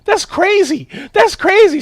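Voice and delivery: high, loud, winded disbelief; breathing hard. Retold or imitated. Patient to the nurse after the swab, winded outrage. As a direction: protest while catching your breath. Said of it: "breathing hard".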